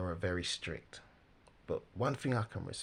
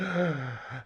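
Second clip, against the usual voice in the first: loud, high mock gasp; shocked gasp; 6 semitones higher, 6 dB louder than usual. Commentator imitates people hearing about dissidents, exaggerated shocked gasp. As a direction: gasp sharply as if scandalized. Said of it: "shocked, shocked".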